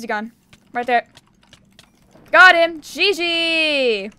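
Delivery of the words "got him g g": high, loud shout of victory; triumphant cheer. Real, not acted. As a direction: yell it out as you land the kill. Catching an opponent, cheering.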